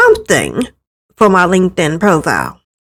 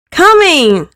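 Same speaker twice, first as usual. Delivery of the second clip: higher, called-out reply; calling back; 8 semitones higher than usual. Acted. Daughter answering her dad's breakfast call, called out. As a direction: shout it through the house.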